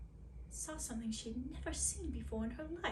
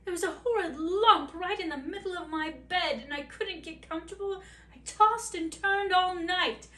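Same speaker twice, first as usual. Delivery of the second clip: louder, higher aggrieved complaint; exasperated complaint; 6 semitones higher, 9 dB louder than usual. Acted. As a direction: vent about the lumpy bed. Princess complains of lump.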